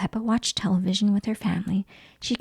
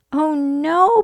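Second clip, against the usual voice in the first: mild dismay; 6 semitones higher, 6 dB louder than usual. Acted. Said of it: "Peppa cried".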